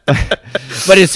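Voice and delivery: ordinary talk, nothing marked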